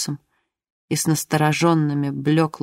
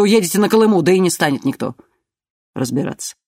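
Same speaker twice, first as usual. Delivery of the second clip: calm menacing threat; 4 dB louder than usual. A narrator's reading. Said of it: "Голос его звучал по-прежнему спокойно".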